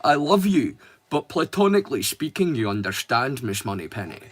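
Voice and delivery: low, soft Scottish Connery voice; Sean Connery impression. A streamer doing Sean Connery in a Scottish accent. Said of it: "in true Sean Connery fashion".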